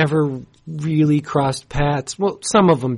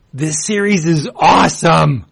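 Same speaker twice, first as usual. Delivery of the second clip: louder, higher, extremely excited rave; real excitement; 4 semitones higher, 4 dB louder than usual. Real, not acted. Comics fan raving to listeners about a new series, extremely excited. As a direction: gush like a thrilled fan. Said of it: "how extremely excited I am".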